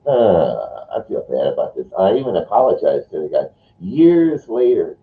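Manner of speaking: normal talking voice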